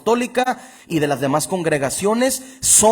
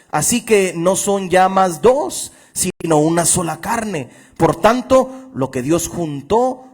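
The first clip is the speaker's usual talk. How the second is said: fervent preaching; 2 semitones higher, 4 dB louder than usual. Acted. Pastor preaching to his congregation, fervent and raised. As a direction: preach it out with fire. Preacher reading scripture with fervor.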